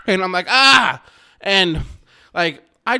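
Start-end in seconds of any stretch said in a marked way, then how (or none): 0.5-1.0 loud, high panicked yell; startled scream. Retold or imitated. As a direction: scream it as if the dog just jumped at you. He screams at the dog.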